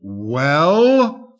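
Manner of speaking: drawn-out menacing snarl; snarling wolf. Acted. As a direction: snarl it as a rising challenge. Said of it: "snarled the wolf".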